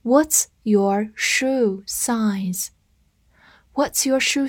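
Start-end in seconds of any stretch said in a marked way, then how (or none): 0.0-2.7 very slow, word by word; slow read-through. Shown as a demonstration. Teacher modelling the shoe-size question for learners, slow read-through. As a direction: read it slowly with a gap after each word. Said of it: "我们慢读一遍".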